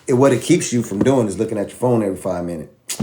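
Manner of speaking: ordinary delivery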